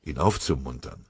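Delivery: ordinary delivery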